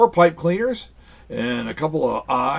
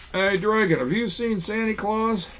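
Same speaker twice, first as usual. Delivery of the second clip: puppet voice; 6 semitones higher than usual. Pretend. Puppet dialogue with dragon.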